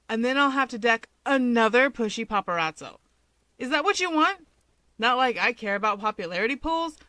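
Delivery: a cocky woman ribbing her friend over the phone; loud teasing female voice on a call; Rumi, teasing. A narrator's reading. Rumi's voice in the call.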